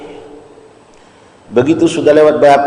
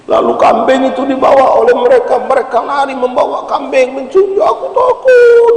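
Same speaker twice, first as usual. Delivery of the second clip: rising into a high frightened cry; frightened plea; 8 semitones higher than usual. Retold or imitated. Weeping servant begs the Prophet, frightened cry. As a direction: let the panic break out at the end.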